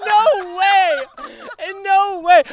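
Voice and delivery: high loud no way; shocked disbelief. Real, not acted. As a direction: shout it in real disbelief. Disbelief at winning number one.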